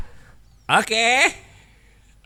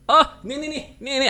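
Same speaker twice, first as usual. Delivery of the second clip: much higher, quick here-here; eager; 12 semitones higher, faster than usual. Real, not acted. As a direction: hand it over eagerly. High eager offering. (bersemangat menyodorkan)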